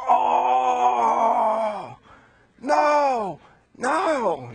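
high, loud anguished "oh no"; dismayed cry. Acted. Brothers seeing the cup in Benjamin's sack, crying out in dismay. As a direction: cry out in horror.

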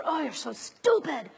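mock self-scolding inner voice; self-berating inner voice. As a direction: nag yourself like a harsh critic. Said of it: "take yourself through psychological torture".

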